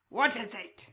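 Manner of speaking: loud sharp what is it, the crow man flaring up; loud sudden raspy what is it; sudden creature snap. Acted. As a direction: bark the question suddenly. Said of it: "speaks with a slow, raspy cadence".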